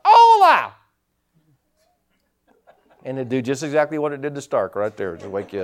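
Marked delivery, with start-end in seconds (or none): 0.0-0.7 high, loud squawking parrot cry. Retold or imitated. Pastor imitating the parrot yelling at breakfast, shrill squawk. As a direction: screech it like a parrot waking you. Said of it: "that bird would yell out".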